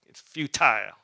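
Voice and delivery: "futile" punched loud, ending like "tile"; emphatic FEW-tile. Shown as a demonstration. Preacher driving home Paul's verdict, loud emphatic "futile" with long "i". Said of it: "sounds more emphatic".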